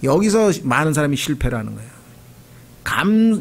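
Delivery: ordinary delivery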